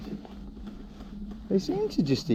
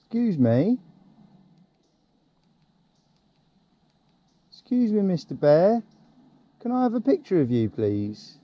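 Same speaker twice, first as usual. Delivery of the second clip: higher, sing-song polite request; sweet talking a bear; 6 semitones higher than usual. Real, not acted. Cyclist politely coaxing a bear to pose, sing-song. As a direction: coax the bear gently, higher than usual.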